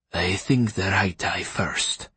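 slow, measured, hushed menace; deliberate it slowly and coolly; softly sinister doctor; calm surgeon captor. A narrator's reading. Saracen calmly planning surgery on victim.

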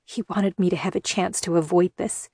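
plain reading voice

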